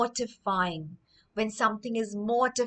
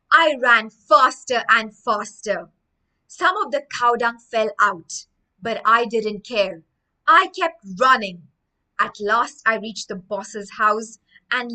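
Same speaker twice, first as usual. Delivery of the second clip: story reading; 7 dB louder than usual. Acted. Teacher reads Greg's run to the class, dramatic narration. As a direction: read the chase with drama.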